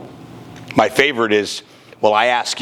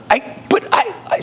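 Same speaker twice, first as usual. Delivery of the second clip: flustered stammer. Retold or imitated. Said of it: "And I'm like".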